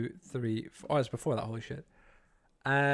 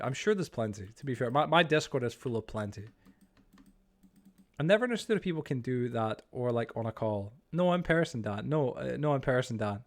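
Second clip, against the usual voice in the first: ordinary talk, nothing marked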